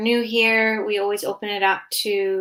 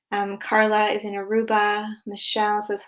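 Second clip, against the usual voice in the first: normal talking voice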